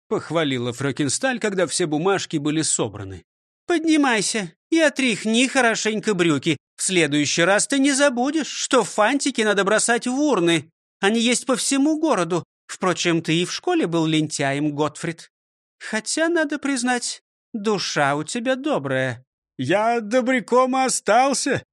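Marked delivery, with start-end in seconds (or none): 3.7-19.2 higher, scolding schoolmistress voice; stern old teacher. Acted. 19.6-21.6 lightly voiced, slow pleased director; a hint of a glad old pupil; pleased shopkeeper. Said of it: "сказал директор Грильяш, радуясь".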